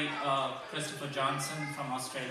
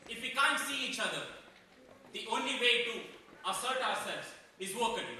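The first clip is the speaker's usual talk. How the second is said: lightly acted proud guard dog looking back; a touch of wounded superiority, telling his side; Boris; 7 semitones higher than usual. Rottweiler recounts the barking feud, mildly exasperated.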